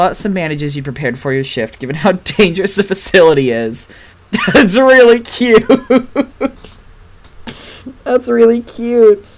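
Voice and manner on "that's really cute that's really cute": laughing through the words, slowed down. Real, not acted. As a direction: melt into giggles while saying it. Laughing at Jeremy's sweet gesture.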